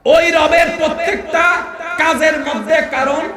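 loud, slow sermon build to a shout; preaching at full force. Acted. Preacher thundering to crowd about God's commands. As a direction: hammer each reason home.

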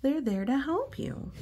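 plain reading voice

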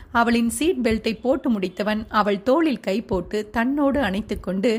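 plain reading voice

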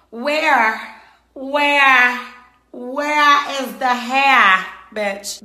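loud, high, slowed-down demand for the hair; outraged demand. Acted. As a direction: drag out each where in disbelief. Furious demand about missing hair.

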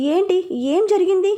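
lost and baffled; bewildered. A narrator's reading. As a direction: ask it in confusion, pitch lifted. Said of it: "అయోమయంగా అడిగాను".

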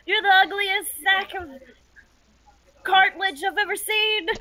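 high-pitched hollered mockery; yelled insult. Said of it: "yell out this insult against him".

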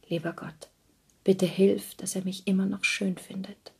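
hushed little prayer, fading to a breath; whispered prayer. A narrator's reading. Della whispers a prayer as Jim comes up the stairs. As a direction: breathe a quick prayer to God. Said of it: "und nun flüsterte sie".

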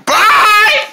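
a high, loud lady's yell; loud squawked goodbye. Acted. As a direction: screech the goodbye. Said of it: "she is loud".

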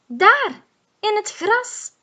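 high, loud call to a friend. Acted. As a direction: sing out excitedly, pointing. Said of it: "roept Boe".

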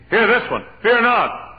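high, loud preacher's call; proclaimed promise. Acted. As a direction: ring it out to the congregation.

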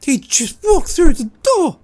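high, excited yelp. Real, not acted. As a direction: blurt it out, thrilled. Excited at target's entry.

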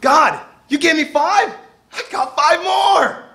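thrilled, rising and near shouting; the joyful servant calls out to his master; happy servant. Retold or imitated. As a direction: proudly show off the doubled gift. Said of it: "Who looks happier here?".

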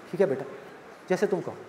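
soft, low, defeated murmur; meek father. Acted. Father giving in to his demanding daughter, soft and low. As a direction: give in like a beaten father.

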